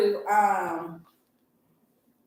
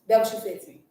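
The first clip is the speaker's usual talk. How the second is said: ordinary talk, nothing marked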